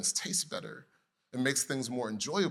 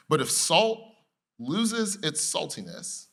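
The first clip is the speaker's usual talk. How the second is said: slow emphasis; 4 semitones higher, 4 dB louder, slower than usual. Acted. Slow scripture quote.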